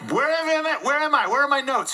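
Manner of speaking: a speaker suddenly lost on stage; flustered. Real, not acted. Speaker who has lost his notes, flustered and high.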